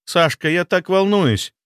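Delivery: high, slow, anxious woman's voice; nervous confession. A narrator's reading. Girlfriend to boyfriend before her trial, nervous. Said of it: "призналась она".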